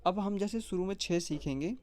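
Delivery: ordinary talk, nothing marked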